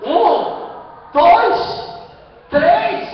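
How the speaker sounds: high, loud count, one word at a time; shouted count. Acted. Preacher re-living Brazil goals for the congregation, shouted count. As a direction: call out each number with rising alarm.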